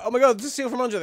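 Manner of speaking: high, excited fan squeal. Acted. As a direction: gush like starstruck fans. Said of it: "And they're".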